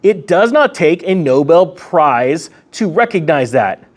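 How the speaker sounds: normal talking voice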